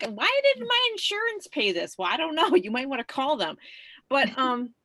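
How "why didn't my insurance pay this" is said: high, mocking voice of a complaining patient; mock caller whining about a bill; mock whiny caller. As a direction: squeak it out like an annoyed caller on the phone. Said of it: "I'm like, like".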